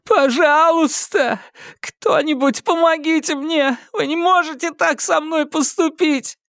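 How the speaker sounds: put-on high, panicked boy pleading for help; отчаянная мольба. A narrator's reading. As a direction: cry out desperately for rescue. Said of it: "срывающимся от отчаяния голосом". (панический крик о помощи)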